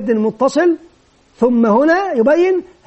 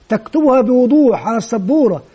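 ordinary talk

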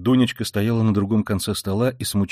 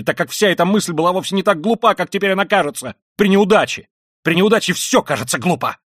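high, loud, impassioned ranting; frenzied tirade; 6 semitones higher, 5 dB louder than usual. Acted. Raskolnikov ranting at his sister, frenzied and loud. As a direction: hurl it all out in frenzy.

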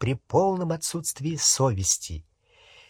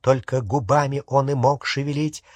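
ordinary delivery, nothing marked

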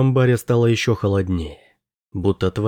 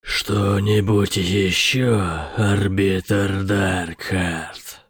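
demon voice; much slower than usual. A narrator's reading. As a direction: drag the words out like a demon. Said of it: "Демон издал жуткий ... похожий на смех".

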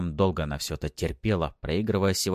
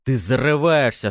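loud, raised threat; angry warning; 6 semitones higher, 8 dB louder than usual. A narrator's reading. Husband warning wife after striking her, angry. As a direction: come down hard on her.

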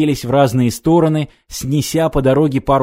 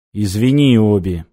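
plain reading voice

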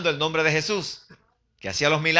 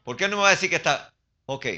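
normal talking voice

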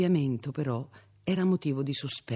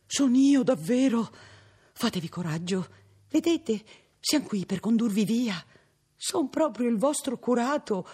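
put-on high, loud flustered old priest voice; country priest calming the girl, high and flustered; fussy old priest reassuring; 10 semitones higher, 5 dB louder than usual. A narrator's reading. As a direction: reassure her, flustered and eager.

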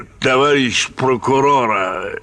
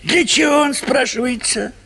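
indignant; 12 semitones higher, faster than usual. Acted. Indignant rhetorical question.

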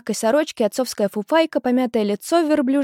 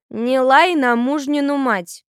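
slow, loud, higher-pitched reprimand; grunted rebuke; 5 semitones higher, 5 dB louder, slower than usual. A narrator's reading. Grandmother rebuking her son's wife, stern. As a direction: put the younger woman in her place, drawing it out. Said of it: "крякнула баба Олда".

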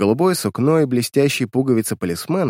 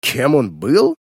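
mocking echo; 3 semitones higher, 4 dB louder, much slower than usual. A narrator's reading. Uncle echoing his niece's question, mockingly. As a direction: question thrown back with a sneer. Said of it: "насмешливо проговорил Вестон".

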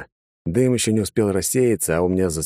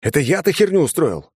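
high, loud indignant outburst; outraged retort, voice jumping up; exploding in outrage; 10 semitones higher, 4 dB louder than usual. A narrator's reading. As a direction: blow up at the accusation. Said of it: "а затем взорвался".

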